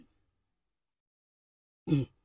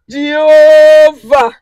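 crying out; 7 dB louder, much slower than usual. Real, not acted. Fervent cry to God.

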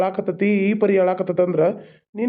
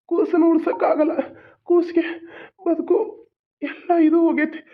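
breaking up while delivering the bad news; tearful grief; 7 semitones higher than usual. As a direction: voice rising high into a near sob. Doctor gives bad news.

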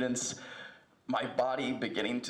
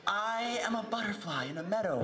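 soaring spoken-word crescendo; building to a shout; 12 semitones higher than usual. Acted. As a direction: swell into a triumphant shout. Poem builds to shouted list.

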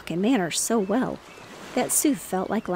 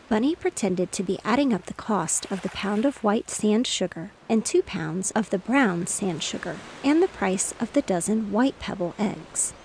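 ordinary delivery, nothing marked

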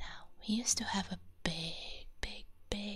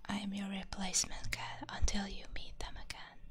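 ASMR whisper. Real, not acted. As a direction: whisper gently as if not to wake anyone.

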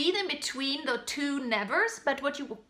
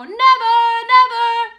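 never never belted louder; mistake: overpushed nevers; wrong take, pushed; 6 semitones higher, 10 dB louder than usual. Shown as a demonstration. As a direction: hammer the nevers. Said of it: "And I also don't go".

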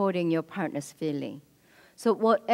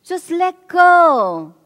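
exasperated scolding; 7 semitones higher, 8 dB louder than usual. Retold or imitated. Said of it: "The minimizing would be".

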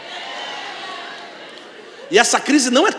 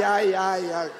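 ordinary talk, nothing marked

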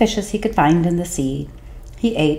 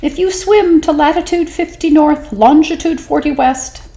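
a tiny sly squeak; 'stute fish voice; 10 semitones higher, 3 dB louder than usual. Acted. Small fish tempting the whale, high and sly. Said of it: "said the 'stute fish".

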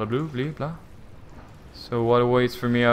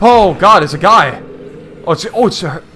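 louder, higher frightened cry; startled; 7 semitones higher, 8 dB louder than usual. Real, not acted. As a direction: jolt at the sudden figure. Creature appears suddenly.

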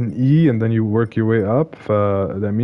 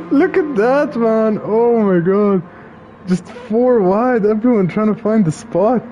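excited; 9 semitones higher than usual. Real, not acted. Excited about replay.

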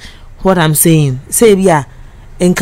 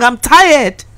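tearful; 7 semitones higher than usual. Real, not acted. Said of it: "I think I'm crying".

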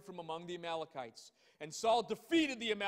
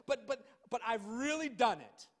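high insistent but-but-but; whining Saul; 3 semitones higher, 4 dB louder than usual. Retold or imitated. Saul insisting to Samuel he obeyed, whiny. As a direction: stammer out the excuse. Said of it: "He keeps saying over and over".

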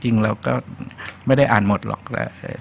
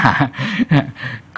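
amused chuckle; 3 semitones higher than usual. Real, not acted. Laughs at his own story.

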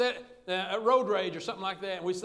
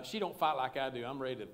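usual speaking voice

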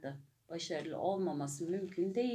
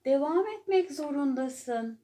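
higher, loud, firm insistence; tiger insisting; 7 semitones higher, 8 dB louder than usual. A narrator's reading. Tiger loudly insisting the exhausted elephant keep going. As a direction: push the elephant hard not to quit. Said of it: "dedi kaplan".

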